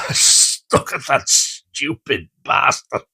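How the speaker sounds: slow, breathless laughing whisper; wheezing laugh quote. Retold or imitated. Teacher watching the boy run, whispered laughing. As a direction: squeeze the words out through laughter. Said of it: "one of them go".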